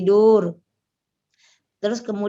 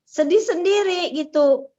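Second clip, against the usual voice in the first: near tears; 7 semitones higher than usual. Real, not acted. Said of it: "terus saya nangis".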